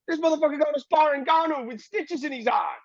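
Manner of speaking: high, alarmed imitation of the older man; hammed-up shocked trainer telling him off; mock Bill Haney. Bill Haney warning him not to spar, high mock alarm. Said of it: "And he's like".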